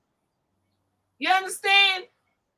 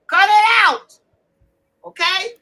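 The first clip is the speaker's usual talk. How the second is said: higher, louder "cut it out"; loud scold; 5 semitones higher, 7 dB louder than usual. Real, not acted. Host scolding the women, loud and high. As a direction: scold them hard.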